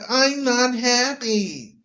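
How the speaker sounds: high, slow, mock-whiny wife; unhappy wife. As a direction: whine it like a dissatisfied wife. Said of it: "She said".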